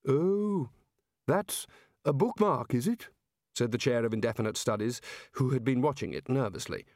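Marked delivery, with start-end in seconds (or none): 0.1-3.1 high, wary, doubtful; nervous old wizard. Acted. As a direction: eye it with suspicion. Nervous chair eyes the bookmark.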